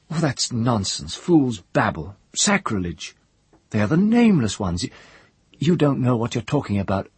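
a proud priestess scoffing at sacrilege; scornful young priestess. Acted. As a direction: dismiss him as a babbling fool.

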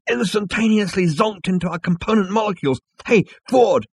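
high, loud and quick, ending in a shout; excited. Acted. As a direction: burst out, then call across. Zaphod excited, calls out.

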